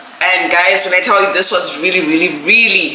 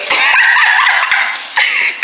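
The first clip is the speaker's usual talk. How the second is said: high, airy burst of laughter; overjoyed laugh. Real, not acted. Laughs on seeing the gift.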